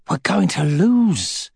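slow, desperate inner voice; desperate thought. A narrator's reading. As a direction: think it in sudden despair. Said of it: "with sudden desperation".

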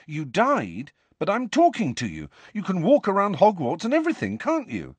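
put-on eager young boy, pressing; Harry pushing for an answer; boy's voice. A narrator's reading.

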